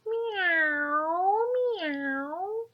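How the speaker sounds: high rising meow, meow like a kitten; high cat meow. Acted. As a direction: mew it up high. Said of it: "and the cat said".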